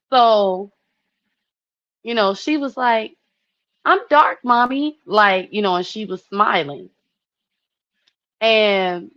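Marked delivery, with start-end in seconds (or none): 3.8-5.0 put-on high, loud little girl voice; happy little girl. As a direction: chirp it like a proud small child. Daughter voiced, smiling.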